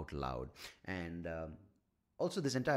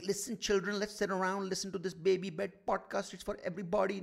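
put-on higher, cheery story voice inviting kids; storyteller to children; 11 semitones higher, 3 dB louder than usual. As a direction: call the children round like a kindly host.

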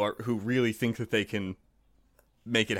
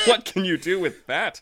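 scornful, laughing; 7 semitones higher, 6 dB louder than usual. A narrator's reading. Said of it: "said the other".

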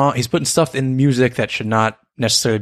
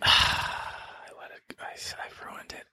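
defeated whisper; 13 dB quieter, slower than usual. Real, not acted. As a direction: sigh then whispered. Dejected after getting pun wrong.

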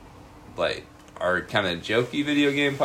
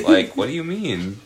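ordinary talk

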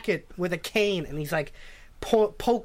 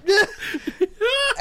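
breaking into high laughter; squealing laugh; 14 semitones higher, 4 dB louder than usual. Real, not acted. Laughing retelling.

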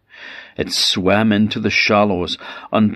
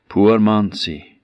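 usual speaking voice

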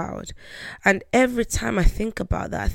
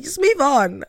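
high, dismissive sing-song; patronizing; 8 semitones higher, 5 dB louder than usual. Retold or imitated. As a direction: brush her off condescendingly. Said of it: "Very patronizing".

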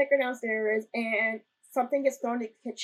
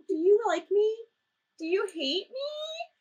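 high-pitched, drawn-out questioning; sweet little mock plea; cute imitation; 9 semitones higher than usual. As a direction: mimic him timidly asking the ghost, voice lifted. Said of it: "he's just so cute".

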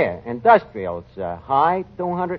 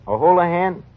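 ordinary talk

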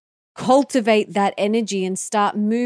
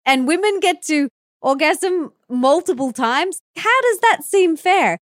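rising incredulous mock protest, climbing higher; imagined men's protest; 6 semitones higher than usual. Host voicing doubtful men, incredulous. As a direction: play the baffled man objecting. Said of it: "the men might be thinking".

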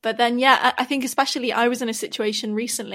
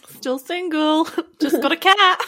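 laughing mock confession; 6 semitones higher, 5 dB louder, slower than usual. S1 mocks single-life line.

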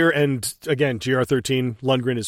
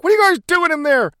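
high, louder mock call from outside; guy outside shouting; 6 dB louder, faster than usual. The guy outside calling in to them, high loud mock shout. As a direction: yell it up high through the door.

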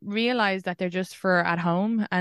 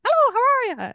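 high, loud imitation of an Irish accent; mock Irish accent parroted back; 5 dB louder than usual. American parroting an Irish comedian's accent to her face, mocking Irish lilt. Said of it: "they're like, parroting my accent".

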